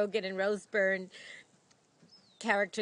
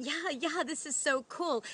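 higher-pitched mock enthusiasm; excited chiming in; 6 semitones higher than usual. Wife joining husband's spontaneous moment, imitated excitement. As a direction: chime in eagerly, imitating the wife. Acted film quote, wife chimes in.

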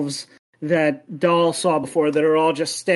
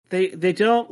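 ordinary delivery

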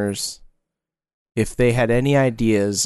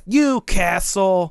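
put-on toddler voice; 7 semitones higher, much slower than usual. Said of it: "you'd be like".

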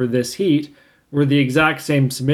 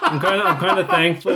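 laughing; 4 semitones higher than usual. Real, not acted. Repeats line laughing.

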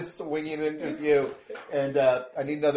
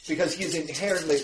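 usual speaking voice

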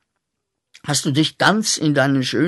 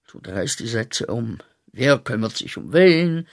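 usual speaking voice

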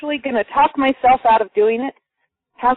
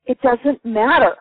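normal talking voice

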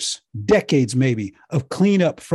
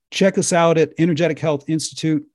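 ordinary talk, nothing marked

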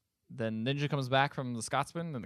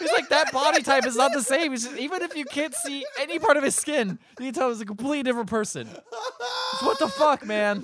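high, loud squeal of disbelief; laughing exasperation; 13 semitones higher, 6 dB louder than usual. Real, not acted. Friend ranting about the impossible body swap, helplessly exasperated. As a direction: rant at the top of the range, cracking up.

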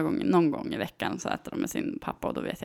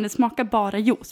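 usual speaking voice

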